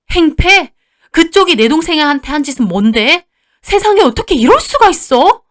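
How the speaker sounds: high, loud incredulous outrage; enraged yelling. Acted. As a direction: shout down the mother-in-law. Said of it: "분에 못 이겨서 고래고래 소리를 질렀어요". (분에 못 이겨 고래고래 소리치는 말투)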